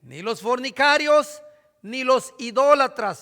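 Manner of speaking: solemn scripture reading. Acted. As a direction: declaim "No te equivoques" slowly, every word heavy.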